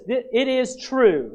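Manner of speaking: "It is true" drawn out very slowly, each word weighed; slow down hard on "It is true"; slow emphatic meaning. Shown as a demonstration. Preacher defining amen for the church, very slow and deliberate. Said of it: "It really means this".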